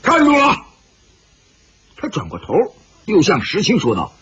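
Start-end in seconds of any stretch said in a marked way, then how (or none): 0.0-0.6 high, sharp shouted command; stern shout. A narrator's reading. Bai Wanjian orders his men to guard them, shouting sternly. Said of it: "白万剑厉声喝道".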